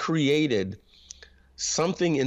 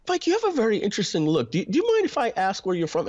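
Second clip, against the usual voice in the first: higher-pitched imitation of a polite, curious stranger; curious questioner; 9 semitones higher than usual. People who look at him and ask where he's from, high curious voice. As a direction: mimic someone asking delicately, pitched up high.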